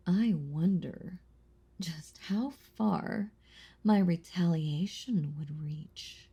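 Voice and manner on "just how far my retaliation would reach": slow, ominous whisper. Acted. Vampire warning of her retaliation, slow ominous whisper. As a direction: draw it out in a menacing whisper.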